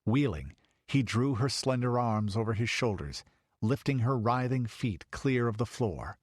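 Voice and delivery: ordinary delivery, nothing marked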